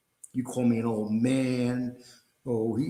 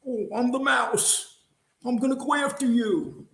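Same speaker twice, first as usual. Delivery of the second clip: mocking tough-guy quote; 10 semitones higher than usual. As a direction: drag it out high and mocking, like a poser bragging. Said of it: "you act like a tough guy".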